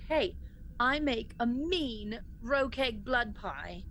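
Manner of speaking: normal talking voice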